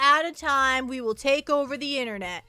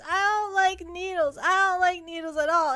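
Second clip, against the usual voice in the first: whiny complaint; 6 semitones higher, 4 dB louder, slower than usual. Real, not acted. Whining about needles and shots.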